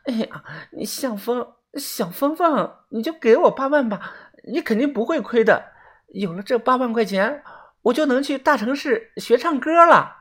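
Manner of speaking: high, soft cutesy pleading; wheedling, coquettish. A narrator's reading. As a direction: coax sweetly like a spoiled sweetheart. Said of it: "晃着撒娇地说道". (细声撒娇央求)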